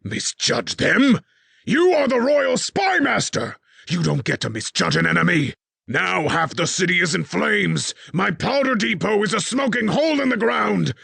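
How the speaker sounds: high, loud raging tirade; furious king. A narrator's reading. King rants at his spy master over the burning city, raging shout.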